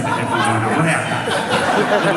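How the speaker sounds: laughing. Real, not acted. Mock startled operator, laughing.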